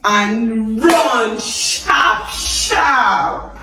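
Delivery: slow, hammed-up yell of run sharp, sharp; mock alarm, drag out run sharp, sharp; mock shout. Teacher joking about fleeing trouble, hammed-up slow shout. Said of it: "serious problem".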